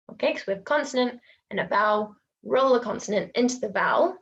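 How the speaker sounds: ordinary talk, nothing marked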